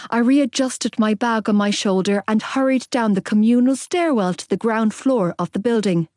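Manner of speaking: plain reading voice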